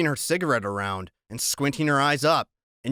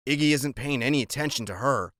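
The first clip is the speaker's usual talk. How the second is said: usual speaking voice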